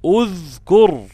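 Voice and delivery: اذكر drawn out very slowly, udhkur; slow demo. Shown as a demonstration. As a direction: stretch اذكر to show the third letter. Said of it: "اذكروا".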